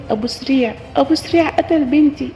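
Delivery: high-pitched distraught calling, short of a full scream; hysterical cry, acted. Mother calls villagers after finding her daughter dead, acted hysterical cry. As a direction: cry out for help in acted panic. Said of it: "طلعت أصرخ وأنا بقول".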